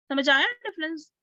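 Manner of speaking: ordinary talk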